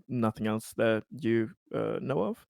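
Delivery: ordinary delivery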